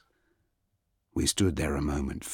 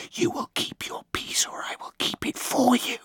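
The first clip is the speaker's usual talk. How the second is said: cold, unearthly whisper; eerie goddess whisper. Acted. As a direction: breathe it like a ghost goddess. Goddess threatens.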